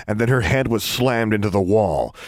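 plain reading voice

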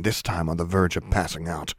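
plain reading voice

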